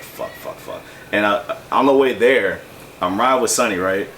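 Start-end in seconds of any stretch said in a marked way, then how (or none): 0.0-0.8 whispered panicked curses under the breath; panicked swearing. Retold or imitated. Stuck at work with a dead phone, whispered panicked swearing.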